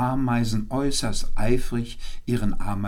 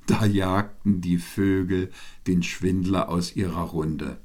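plain reading voice

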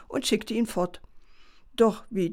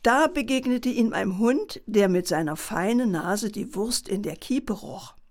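usual speaking voice

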